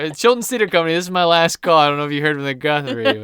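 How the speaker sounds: hammed-up last-chance pitch to a theater; mock audition announcement. Hopeful actor calls another theater company, mock desperation.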